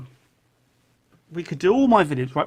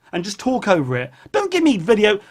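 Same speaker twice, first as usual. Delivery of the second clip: normal talking voice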